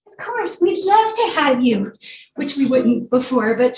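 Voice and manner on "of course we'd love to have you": mock welcoming. NASA mocked as suddenly welcoming.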